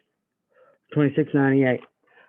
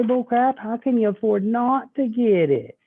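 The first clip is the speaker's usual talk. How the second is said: higher, lively comeback; retold rebuttal; 8 semitones higher than usual. Salesman to customer, mock indignant comeback. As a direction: toss the objection back playfully. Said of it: "I say".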